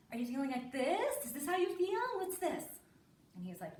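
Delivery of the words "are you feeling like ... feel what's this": high, rising cheerful questions; acted happy. Parent demonstrating happiness to son, brightly overdone. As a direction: put on exaggerated happiness as you ask. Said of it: "that's happy".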